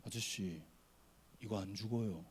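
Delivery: put-on low, soft deadpan boy; unimpressed kid. Boy turning round to pastor on the ride, low deadpan. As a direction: say it coolly, like a kid reassuring a scared adult. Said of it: "걔가 뒤돌아보면서 저한테 말 거는 거예요".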